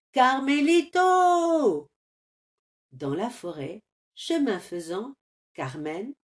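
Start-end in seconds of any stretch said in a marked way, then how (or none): 0.1-1.9 slow, high, loud call. Acted. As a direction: call out searching. Bellino appelle. (Bellino appelle Carmelito au loin)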